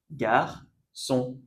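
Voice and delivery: "Garçon" in two separate syllables, gar, son; soft C. Shown as a demonstration. Teacher repeating the corrected word for learners, syllable by syllable. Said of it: "On prononce garçon".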